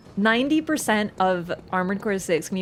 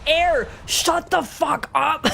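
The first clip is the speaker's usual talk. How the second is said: higher, slow, laughing yell at Air; mock outburst; 7 semitones higher, much slower than usual. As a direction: yell it out slowly while cracking up. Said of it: "And then I'm just like".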